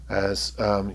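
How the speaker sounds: ordinary talk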